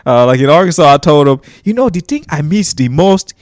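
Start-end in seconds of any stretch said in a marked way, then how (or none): 1.6-3.3 higher-pitched Nigerian character voice; Nigerian accent. Retold or imitated. Comedian in character answering a fan's question, Nigerian accent. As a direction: answer as a homesick Nigerian newcomer. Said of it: "I'm talking just like this".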